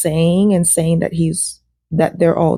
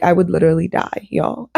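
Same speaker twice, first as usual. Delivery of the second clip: normal talking voice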